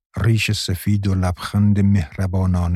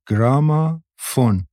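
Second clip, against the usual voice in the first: گرامافون stretched slowly, in separated parts, pitched up; word drawn out; 5 semitones higher, much slower than usual. A narrator's reading. Girl teaching Maryam a new word, گرامافون drawn out slowly.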